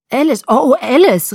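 high, loud, overwhelmed calling; excited cry. A narrator's reading. Bella crashing into Alice at their reunion, crying out. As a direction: cry out the name as you collide. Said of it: "rief ich, als ich gegen sie knallte".

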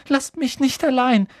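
high, loud plea; crying out. A narrator's reading. Neville begs not to be left behind, calling out. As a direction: call out in alarm, pitched right up. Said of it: "rief Neville".

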